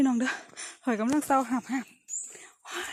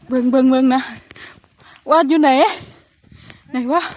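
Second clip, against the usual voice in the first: loud call over the chaos; shouting; 15 dB louder than usual. Real, not acted. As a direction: yell it out loud in the chase. Shouting in the chaotic chase.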